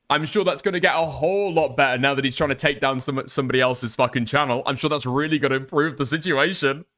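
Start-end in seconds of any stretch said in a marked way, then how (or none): none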